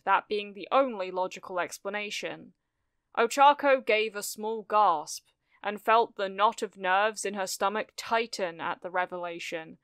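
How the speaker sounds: ordinary delivery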